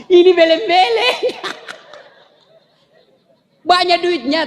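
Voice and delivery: usual speaking voice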